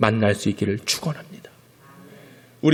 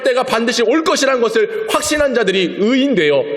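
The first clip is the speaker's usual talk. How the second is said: much higher, louder exhortation; fervent preaching; 7 semitones higher, 6 dB louder than usual. Real, not acted. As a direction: drive the call to faith home. Preaching at full force.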